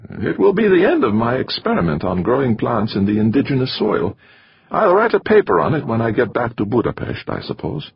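put-on high, weary foreign-accented voice, fading at the end; sighing accented biologist. A narrator's reading. Torok mourning his Mars experiment, weary and accented. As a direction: resign yourself to losing the experiment. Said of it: "The biologist sighed".

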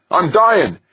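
loud, very high-pitched protest; anguished cry. A narrator's reading. Westfall telling the men she is dying, crying out. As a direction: cry it out in high-voiced panic. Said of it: "Westfall cried".

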